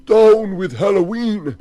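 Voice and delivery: high, loud angry shout; man trying to shout down Halloween; intended shout. Acted. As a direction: bellow it as he means to. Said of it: "He wanted to say".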